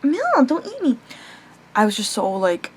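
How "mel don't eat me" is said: pitched way up, playful scolding of the cat; high, teasing pet talk. Real, not acted. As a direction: squeak it at the pet. Scolding the pet mid-video.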